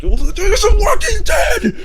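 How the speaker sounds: very high, slow, full-throated shout; screamed answer, strained high; excited shout. Retold or imitated. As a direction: yell it at the room like it's obvious. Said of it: "I'm like".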